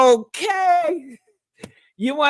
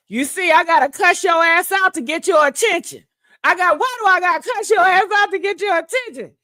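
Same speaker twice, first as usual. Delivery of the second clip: loud, raised-pitch imitation of a fed-up man; mock scolding; 5 semitones higher, 4 dB louder, faster than usual. Woman imitating her man cussing her out, loud mock scolding. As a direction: act out his exasperated telling-off, speeding up on the repeat.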